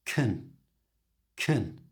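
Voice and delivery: "kun" with an almost silent vowel; reduced vowel. Shown as a demonstration. Teacher showing the weak form of can. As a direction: clip "can" to a short "kun". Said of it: "so it's just".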